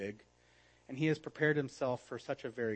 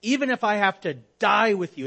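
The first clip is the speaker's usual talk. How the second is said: loud, high, brash vow; boastful Peter; 7 semitones higher, 11 dB louder than usual. Acted. Peter vows to Jesus he will die with him, loudly and brashly. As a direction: throw out the vow like a bold boast.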